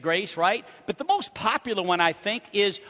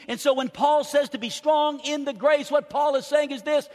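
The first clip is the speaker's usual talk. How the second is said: high-pitched pulpit declaration building in volume; emphatic preaching; 6 semitones higher than usual. Real, not acted. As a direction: proclaim it with rising force. Preaches, voicing Paul's promise.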